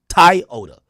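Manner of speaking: Toyota with a very loud Jamaican "TIE", then "ota"; Jamaican accent. Shown as a demonstration. Insisting it's Jamaican, forceful Jamaican accent. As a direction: hit the first syllable of Jamaican Toyota hard. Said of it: "That's Jamaican shit".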